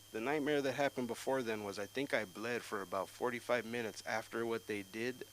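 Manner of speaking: usual speaking voice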